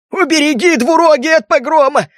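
high, alarmed exclamation; rumbling cry. A narrator's reading. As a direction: cry out a plea for protection. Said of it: "пророкотал тот". (возглас-мольба, высоким голосом)

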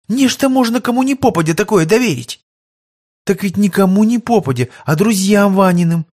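bright, quick, arguing maid voice; lively young maid. Acted. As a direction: talk her out of it, fussing. Anyuta's objecting speech. (бойкая служанка спорит)

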